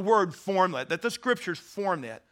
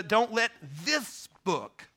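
THIS stretched out slowly and set apart; slow down and lean hard on the word this; a deliberate, drawn-out "this" before the noun; stress on THIS; 2 semitones higher, slower than usual. Shown as a demonstration. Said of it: "you begin to emphasize the different words".

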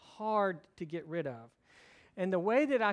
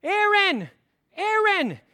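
much higher, louder mock shout over a crying baby; child shouting; 14 semitones higher, 14 dB louder, slower than usual. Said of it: "starts to cry louder".

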